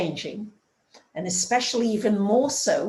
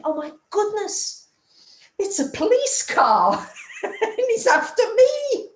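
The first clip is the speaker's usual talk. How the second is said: high, laughing panic at the police car; half-laughing high-pitched oh no; panicked surprise; 13 semitones higher, 4 dB louder than usual. Retold or imitated. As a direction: squeal it out in laughing alarm. Panicked thought seeing police car.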